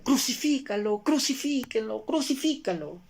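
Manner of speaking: high-pitched chant, no real volume; imitated crowd shouting. Preacher imitating the crowd at Jesus's arrest, high mock shouting. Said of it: "la multitud empezaba a gritar".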